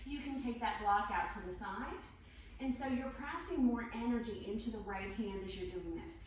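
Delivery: normal talking voice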